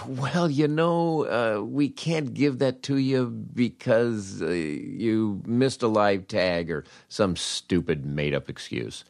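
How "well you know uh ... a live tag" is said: mocking the manager. Manager's excuse acted out.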